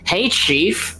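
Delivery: high nasal skull voice; pinched nasal voice; nasally voice. Acted. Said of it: "says with a nasally voice".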